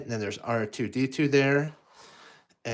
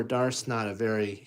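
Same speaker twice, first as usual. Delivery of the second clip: ordinary delivery, nothing marked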